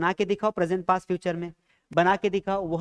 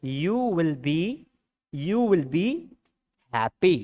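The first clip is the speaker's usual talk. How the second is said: careful model phrase; 2 semitones lower, much slower than usual. Shown as a demonstration. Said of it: "हमने will be लगा दिया".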